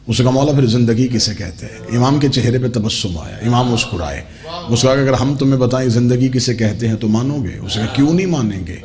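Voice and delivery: low, measured retelling of a holy dialogue; hushed sermon storytelling. Acted. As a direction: draw the listeners in with a low voice. (دھیمی آواز میں قصہ گوئی)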